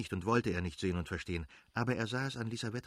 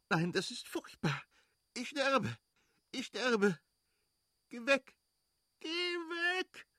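much higher, anguished cries of fear; screaming in pain; slower than usual. Acted. Kitty in labour screams at Levin to go away, much higher. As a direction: scream as the pain overwhelms her. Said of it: "schrie sie".

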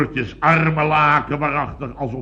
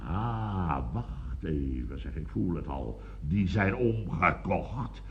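softer, lower, slow conspiratorial murmur; hushed suspicion; 9 semitones lower, 10 dB quieter, slower than usual. Acted. Man confiding his suspicion to Biels, soft slow murmur.

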